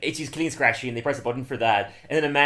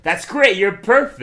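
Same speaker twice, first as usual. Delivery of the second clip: higher and louder, overdone praise; mock gushing; 7 semitones higher, 10 dB louder, slower than usual. Producer flipping to praise, loud mock gushing. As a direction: gush it, hammed up. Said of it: "He's like".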